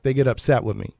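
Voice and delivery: ordinary delivery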